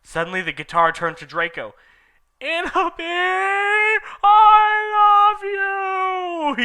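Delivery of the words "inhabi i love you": high, loud, crying cry; sad shout. Acted. Draco declaring love, high loud sobbing shout. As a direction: cry it out in anguish. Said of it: "he shouted sadly".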